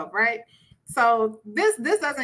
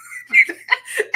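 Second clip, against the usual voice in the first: laugh; 5 dB louder than usual. Real, not acted. Laughing after acting husband.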